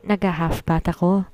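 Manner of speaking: ordinary delivery, nothing marked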